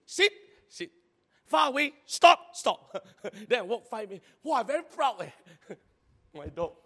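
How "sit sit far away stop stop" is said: high, loud commands to a dog; barked dog-training commands. Retold or imitated. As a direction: call out sit and stop.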